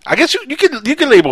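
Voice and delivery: high, loud burst of delight; excited laughing reply. Real, not acted. Answering his co-host's joke, excited high. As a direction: jump in amused and eager.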